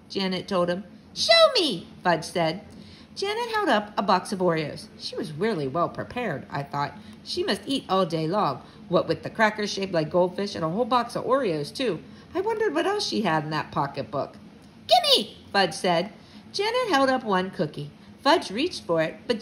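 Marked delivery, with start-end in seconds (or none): 1.1-1.9 put-on high, loud toddler demand; demanding little kid. A narrator's reading. 14.9-15.4 put-on high, loud toddler grab; demanding little kid. A narrator's reading.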